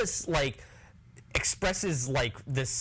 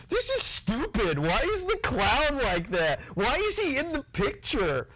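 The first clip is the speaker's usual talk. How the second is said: exasperated laughing; 11 semitones higher than usual. Retold or imitated. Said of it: "I was like".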